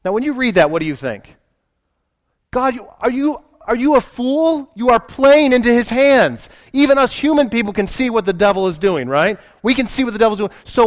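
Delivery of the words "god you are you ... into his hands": incredulous outburst. Retold or imitated. Reader questions God in disbelief.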